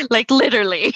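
loud, high laughing sob. Retold or imitated. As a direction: laugh through a mock sob. Laughing, imitating her crying.

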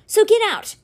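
high, loud dismissal; angry order. A narrator's reading. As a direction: throw him out with it. Angry brother ordering intruder out.

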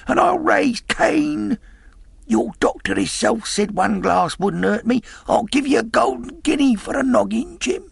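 put-on high, desperate pleading old sailor. A narrator's reading. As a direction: beg frantically for a drop of rum. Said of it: "he continued in the pleading tone".

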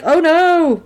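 hammed-up high, loud dismay; mock dramatic wail; fake death acting. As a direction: cry out like a bad actor dying. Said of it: "like, a terrible fake death scene".

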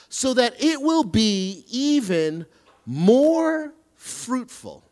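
slow, solemn reading of a verse; scripture reading. Acted. Pastor reads the gardener verse to the congregation, slow and solemn. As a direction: let each phrase land, slowly.

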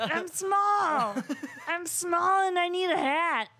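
Bobcat Goldthwait. Acted. Repeating the requested impression for laughs, weird comic voice. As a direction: push the put-on voice way up. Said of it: "do the Bobcat Goldthwait, uh, voice".

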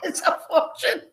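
high, giggling words; giggly quip; laughing. Retold or imitated. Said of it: "And I'm going".

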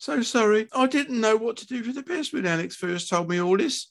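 high, tearful mother explaining; weepy, high-pitched wail; sobbing apology. Acted. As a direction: plead forgiveness through tears. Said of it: "mother was sobbing openly".

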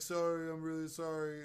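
imitating the frightened kid apologizing; mock meek apology. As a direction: squeak out a cowed apology. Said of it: "and he was like".

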